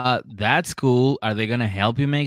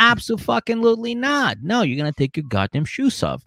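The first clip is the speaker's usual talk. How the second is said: high, emphatic "Abso-fucking-lutely not"; mock indignant refusal; 8 semitones higher than usual. Host refusing a guest who won't remove shoes, mock indignant. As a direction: shut her down hard. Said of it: "I'll be like".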